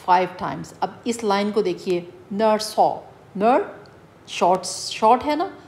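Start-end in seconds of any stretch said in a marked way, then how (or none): 2.3-3.9 "नर" short and light, "सॉ" long; short-long metre. Shown as a demonstration. Teacher showing students short and long syllables, "नर" light before "सॉ". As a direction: mark the beat in "नर सॉ", light then long. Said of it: "उसको आपने हल्का pronounce करना है".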